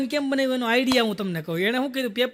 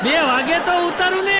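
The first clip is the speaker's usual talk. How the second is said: higher, louder defensive shout; indignant retort; 6 semitones higher, 5 dB louder than usual. Retold or imitated. Husband snapping back at wife over the cooker, loud. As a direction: throw it back at her, ને rising loud. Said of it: "ઈ કે".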